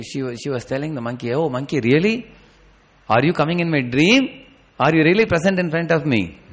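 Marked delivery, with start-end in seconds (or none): 1.3-6.4 amazed disbelief. Acted. Sita amazed to see Hanuman.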